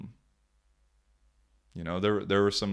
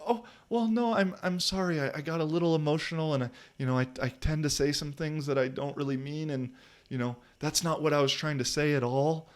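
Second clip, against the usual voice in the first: much higher, sugary fake-sorry voice; phony salesman apology; 6 semitones higher than usual. Mocking the coach's fake apology, in a much higher syrupy voice. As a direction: ooze insincere regret like a salesman. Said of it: "manipulative, recruiting, phony salesman bullshit".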